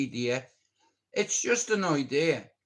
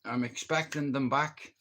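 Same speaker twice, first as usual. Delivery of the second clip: normal talking voice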